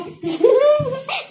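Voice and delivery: a sudden high burst of laughter. Real, not acted. Laughs at her own story.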